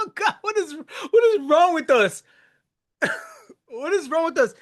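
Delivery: high, incredulous cry through laughter; laughing exasperation. Real, not acted. Man laughing at men's stubbornness, high laughing exasperation. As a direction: crack up while despairing at yourselves.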